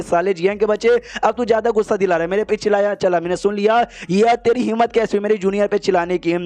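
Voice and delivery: ordinary delivery, nothing marked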